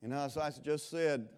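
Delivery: ordinary talk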